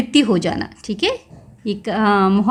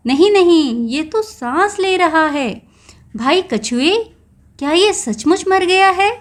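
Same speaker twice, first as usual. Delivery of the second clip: a jackal shouts नहीं नहीं and keeps the pitch up; loud and high; 6 semitones higher than usual. Acted. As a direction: raise the voice in alarm as the jackal doubts. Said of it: "ऊंचे स्वर में क्या बोलता है जोर से". (ऊंचे स्वर में जोर से)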